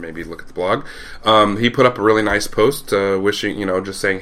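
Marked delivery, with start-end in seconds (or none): none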